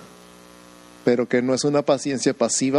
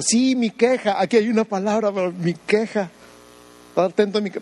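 higher, nagging complainer voice; mock whiny complaint; 7 semitones higher than usual. Pastor imitating a believer stuck on his own complaint, high whine. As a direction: lightly mimic someone insisting on their complaint. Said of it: "Cuando digo".

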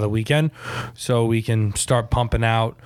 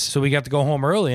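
usual speaking voice

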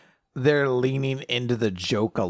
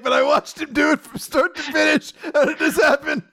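laughing disbelief; 13 semitones higher, faster than usual. Acted. Co-host voicing Finn amazed at the cream puff, laughing. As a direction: act baffled while cracking up. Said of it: "He's like".

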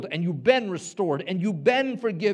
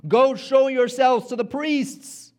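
commanding Jesus; 9 semitones higher, 7 dB louder, slower than usual. Retold or imitated.

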